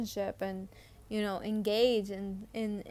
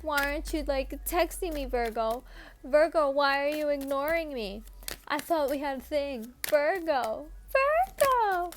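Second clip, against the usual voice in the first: pleading, whiny call to Virgo; hammed-up clingy voice, rising high at the end; mock needy lover; 4 semitones higher, 5 dB louder than usual. Tarot reader voicing a clingy admirer, mock pleading. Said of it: "They're like".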